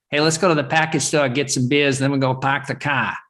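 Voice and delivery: put-on edgy Boston accent, R's dropped. Joking about Boston dialect in an imitated Boston accent. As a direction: put on a Boston accent, "park the car" as "pahk the cah". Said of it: "kind of sounds like Boston".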